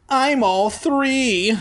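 very high, stretched-out whine; mock-pitiful high complaint; exaggerated whining. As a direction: whine like the biggest crybaby. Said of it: "I told them like a triple bitch".

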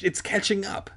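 high-pitched urgent warning; mild narrated screech. A narrator's reading. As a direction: raise the pitch in alarm. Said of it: "I screeched".

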